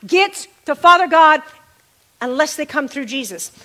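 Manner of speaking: rising and falling preacher cadence; preaching. Acted. Pastor preaching to his congregation about Jesus, fervent sermon delivery. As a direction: preach it to the room with fervor.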